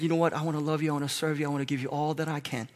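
soft, fading, intimate promise of love; tender devotion. Retold or imitated. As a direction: whisper your heart's vow to God. Tender vow to Christ.

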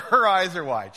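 laughing. Real, not acted. Preacher laughing loudly at the old lady's reaction. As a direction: laugh through the line.

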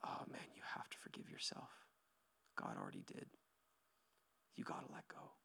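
soft, whispered comfort to the hurting; hushed whisper. Real, not acted. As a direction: lean in and whisper it gently. Tender altar-call moment.

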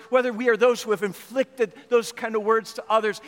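normal talking voice

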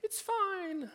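very high sing-song mock denial; pretending all's fine. Pretending the painful situation isn't there, high-pitched mock cheer. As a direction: squeak it out in a falsely carefree falsetto. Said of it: "pretend like you're not in that situation".

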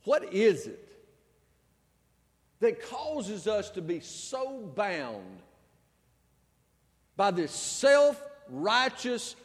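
ordinary talk, nothing marked

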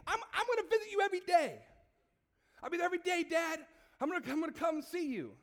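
high, loud eager promise; overwhelmed gratitude. Acted. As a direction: gush a heartfelt vow to dad. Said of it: "you would be overcome with gratefulness".

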